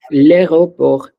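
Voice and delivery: "l'aéroport" loud and drawn out; pronunciation model. Shown as a demonstration. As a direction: model "l'aéroport" slowly and firmly for a student. Teacher models word after student stumbled.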